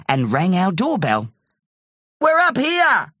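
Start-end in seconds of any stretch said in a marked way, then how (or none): 2.2-3.1 high, carrying call; high-pitched shout to someone below. A narrator's reading. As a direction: holler down from high up. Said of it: "I called down to him".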